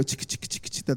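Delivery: whispered chicka chicka mimicking a shaker; soft rhythmic shaker noise; egg shaker sound. Shown as a demonstration. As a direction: imitate a shaker rattle under your breath. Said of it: "You guys know those egg shakers?".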